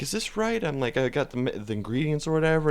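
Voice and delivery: high, soft, tentative question; unsure. Retold or imitated. New cook to chef on second day, unsure.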